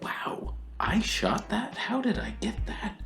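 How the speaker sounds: a photographer whispering in awe at their own footage; amazed whisper. Retold or imitated. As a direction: breathe it out in amazement at your own shot. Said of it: "I'm like".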